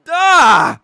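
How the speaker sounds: high, loud sarcastic Duh; mock shout. Answering the museum guard, loud sarcastic shout. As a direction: blurt it out with mocking scorn.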